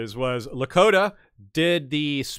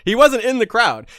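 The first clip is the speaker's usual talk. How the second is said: ordinary talk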